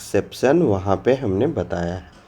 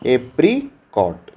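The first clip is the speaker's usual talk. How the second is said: teacher modelling a new word; slow model word; much slower than usual. Shown as a demonstration. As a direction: say apricot slowly and clearly. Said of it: "क्या कहते हैं?".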